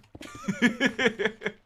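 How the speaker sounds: high bursting laugh at the picture; big laugh. Real, not acted. Streamer cracking up at a gold sponge, big laugh.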